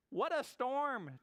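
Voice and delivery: high, slow bird voice; twittering wren. Acted. Wren fleeing into the den, high twittering exclamation. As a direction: twitter it like a small storybook wren. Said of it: "twitters wren".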